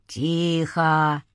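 low, drawn-out hush; deep command. A narrator's reading. Spirit silencing the cursing man, deep command. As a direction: silence them with a deep, unhurried word.

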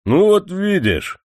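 high, slow smug satisfaction; the manager, pleased with himself, sighs contentedly; satisfied. Acted. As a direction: savour being proven right. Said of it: "удовлетворённо вздохнул Любимов".

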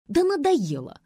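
high, loud exasperation; fed-up snort. A narrator's reading. Nadya snaps that she has had enough, snorting. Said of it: "фыркнула она".

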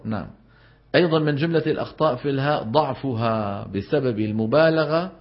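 usual speaking voice

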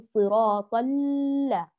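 slow, droning overlong L in ال-; over-stretched lam. Shown as a demonstration. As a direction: drag the L of ال- out far too long. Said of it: "نمططها ونقول".

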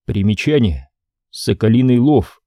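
usual speaking voice

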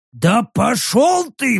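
slow grumbled retort. A narrator's reading. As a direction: mutter it back. Rek's retort.

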